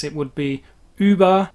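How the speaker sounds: Uber with a rounded German umlaut ü; German Über. Shown as a demonstration. Teacher showing the German Über. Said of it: "The German pronunciation of Uber is".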